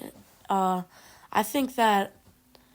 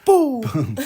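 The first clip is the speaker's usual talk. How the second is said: laughing boom; 9 dB louder than usual. Real, not acted. Cheering the microwave throw.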